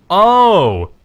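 sudden loud startled oh; surprised. Real, not acted. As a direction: caught off guard mid-commentary. Sudden surprise during live match.

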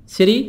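"City" with T as a quick soft D, siri; flap T. Shown as a demonstration. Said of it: "T soft D".

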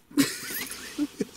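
squeaky chipmunk giggle; high squeaky laugh. Retold or imitated. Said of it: "Sound like a chipmunk".

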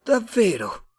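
high, loud alien surprise; Martian. A narrator's reading. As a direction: react as an astonished high-voiced alien. Martian reacts in amazement.

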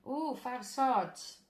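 surprised ooh. Real, not acted. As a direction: react as the card lands with a slow ooh. Card drawn, reacts with alarm.

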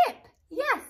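high happy praise; excited. Real, not acted. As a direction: cheer it.

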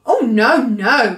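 storybook cry of alarm; cried out. A narrator's reading. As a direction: cry out in protest as a worried giant. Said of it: "cried the B F G".

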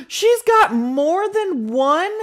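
high, loud slow shocked cry; can't believe what she sees; shocked disbelief. Real, not acted. Shocked at the Sim's food.

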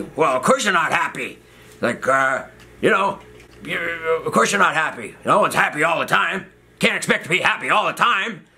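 put-on high-pitched scolding old doctor; mock a cranky doctor telling you off; imitated yelling doctor. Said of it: "he just starts yelling at me".

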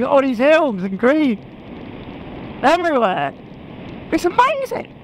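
high, gushing delight at the hills. Real, not acted. Rider gushing over green hills, high and excited. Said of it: "I'm like a big kid".

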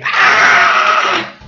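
rough, pitchless scream; coarse comic yell; own mock scream. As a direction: scream hoarsely like a startled man. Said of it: "Or be more like me".

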